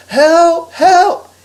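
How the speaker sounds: high, loud yells for help; yelling. Acted. Harry running after the stroller, yelling for help. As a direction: yell it at full voice to anyone around. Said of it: "He also began to yell".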